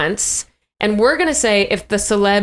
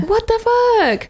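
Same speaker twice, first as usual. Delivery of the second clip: high, slow, stretched disbelief; incredulous; 13 semitones higher, slower than usual. Real, not acted. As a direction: drag it out in disbelief. Outraged at adult pretending.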